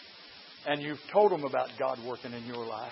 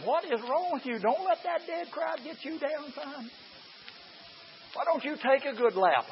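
high, slow mother scolding her son; mother's voice from heaven; 11 semitones higher than usual. Retold or imitated. Son imagining his mother calling down from heaven, higher voice. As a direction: lift the voice into Mama chiding her boy. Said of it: "she's looking down here at me and saying".

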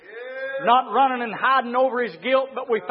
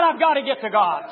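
forceful, coming down from a shout; fervent preacher voicing Abel; urgent declaration; 6 dB louder than usual. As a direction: insist on it with pulpit force. Acting Abel's urgent cry.

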